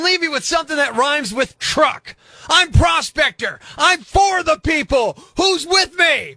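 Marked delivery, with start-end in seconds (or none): 2.5-6.4 louder, slow rousing cry; rally shout. Acted. Host ends his rant rallying listeners, louder rousing shout. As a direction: fire up the crowd.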